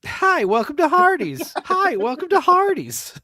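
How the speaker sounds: high, loud, looping stock greeting; high, slowing robotic drive-thru loop; broken record. Retold or imitated. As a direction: repeat it like a stuck recording. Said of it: "Like a broken record".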